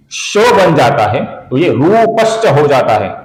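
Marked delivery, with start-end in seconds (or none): none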